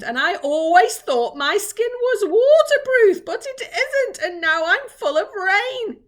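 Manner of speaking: high, loud moaning complaint; groaning. A narrator's reading. Earthworm laments it is full of rain, groaning. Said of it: "the earthworm groaned".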